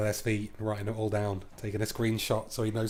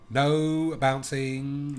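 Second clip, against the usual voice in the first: drawn-out taunt; 4 semitones higher, 4 dB louder, much slower than usual. Pretend.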